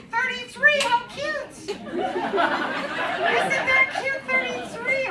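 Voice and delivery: high cooing voice at a younger man. Acted. Comic teasing Josh in the crowd, high and cooing. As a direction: coo over him as if he were a baby.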